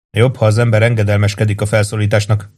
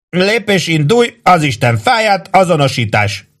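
high-pitched swearing drill orders; high, insistent sentry commands; exasperated command; 9 semitones higher than usual. A narrator's reading. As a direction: call out orders like an irritated sentry. Sentry orders, now cursing.

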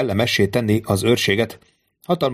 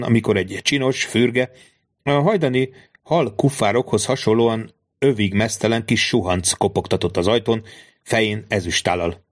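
usual speaking voice

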